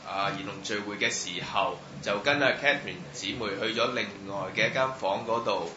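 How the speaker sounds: ordinary talk, nothing marked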